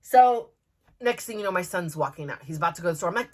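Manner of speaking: normal talking voice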